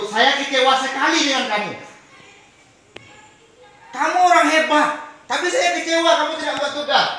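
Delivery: loud, high, harsh scolding of a pupil; loud, high teacher scolding a pupil. Shown as a demonstration. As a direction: scold the class like a disappointed teacher. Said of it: "Ini pernyataan".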